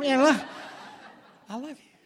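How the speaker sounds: comic loud I love you; laughing. Acted. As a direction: toss it off with a laugh.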